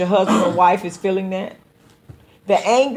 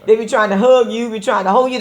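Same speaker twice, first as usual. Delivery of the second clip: ordinary talk, nothing marked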